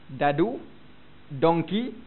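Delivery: ordinary delivery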